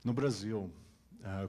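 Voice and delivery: ordinary talk, nothing marked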